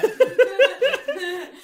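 loud shrieking cackle; high burst of laughter. Real, not acted. Carcajada tras el chiste. (carcajada aguda)

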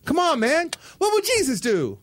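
high, slow mock earnest plea; movie guy's earnest plea. Retelling a Step Up scene, mock earnest high plea. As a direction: ham up the pleading movie character. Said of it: "this is what he said".

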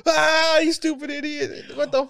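high teasing imitation of the group; mock taunting. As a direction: jeer at the friend in a squeaky voice. Friends mocking Alex.

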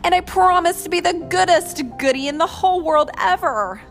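high, loud wheedling; pleading promise. A narrator's reading. Pinned dinosaur promising to be good, high pleading. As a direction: plead hard in a hammed-up voice. T-rex keeps begging.

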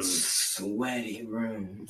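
slow, soft, low sultry voice. Pretend. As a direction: purr it like a late-night host. Seductive mock sign-off.

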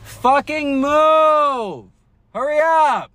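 high, slow drawn-out yell. Real, not acted. Stuck in the drive-thru line, yelling at the driver. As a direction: holler it at the driver ahead. Yelling at car blocking them.

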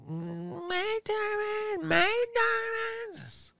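very high, slow falsetto maid calling through the door; sing-song high mock maid knocking. Hotel maid calling to the sleeping guest, high mock falsetto. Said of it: "And the maid's like".

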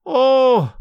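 acted wail of a distraught mother. A narrator's reading. Mother of a lost boy laments, drawn-out wail. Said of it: "wailed Missus Murphy".

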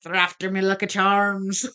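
hammed-up Irish cereal-mascot accent; a joking leprechaun-style Irish lilt; mock Irish accent. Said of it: "weird-ass Irish accent".

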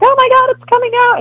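high, loud squealing delight; excited squeal. Retold or imitated. Wife squealing about the new Disney movie, high and loud. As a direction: gush like a kid over the news. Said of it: "the first to be like".

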